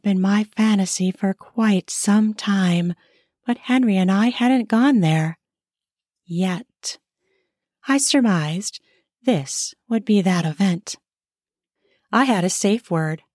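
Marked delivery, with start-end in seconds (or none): none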